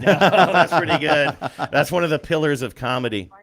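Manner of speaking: talking through laughter; laughing. Real, not acted. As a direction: laugh while you speak. Laughing at the child's answer.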